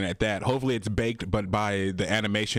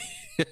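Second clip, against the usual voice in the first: high laugh; 5 dB quieter than usual. Real, not acted. Laughs after impression.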